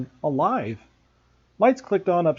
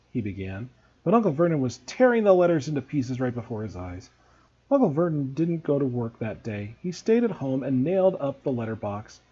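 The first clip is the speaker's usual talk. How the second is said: ordinary delivery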